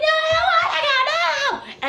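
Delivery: high, loud wail of protest; hammed-up kid's tantrum squeal; mock tantrum. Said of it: "throwing the biggest tantrum".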